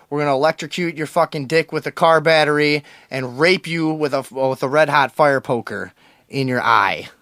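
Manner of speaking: hammed-up radio bully; mock menacing threats. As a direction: pile on over-the-top threats. Mock radio feud with caller.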